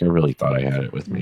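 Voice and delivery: low, soft, deflated mumble; imitated dejection. Man who can't find his jacket, imitated as dejected. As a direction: let it sink down low and quiet, dejected.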